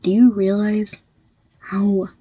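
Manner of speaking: usual speaking voice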